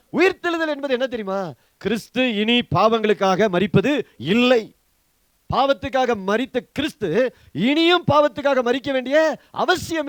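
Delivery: loud, emphatic proclamation; full-force preaching. Real, not acted. Preacher tells believers Christ need never die again, loud and forceful. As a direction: drive each phrase home at full voice.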